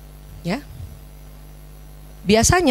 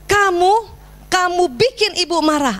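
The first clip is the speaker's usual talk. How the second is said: higher-pitched mock accusation; accusing scold; 6 semitones higher than usual. Shown as a demonstration. Parent wrongly blaming the child for the anger, accusing. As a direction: blame the child pointedly. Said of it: "itu yang keliru".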